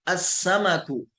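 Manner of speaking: अस्समकु slow, doubled s, no lam; Arabic sun letter demo. Shown as a demonstration. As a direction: hold the ss in अस्समकु. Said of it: "तो यहाँ देखिए".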